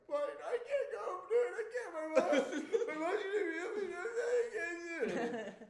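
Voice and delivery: high, loud hammed-up blubbering; mock crying. Boy sent to detention crying before math class, mock bawling. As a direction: wail it in garbled, exaggerated sobs. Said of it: "I started crying".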